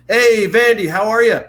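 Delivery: bright famous caller greeting Vandy; Peyton calling. Retold or imitated. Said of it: "Peyton calls and go".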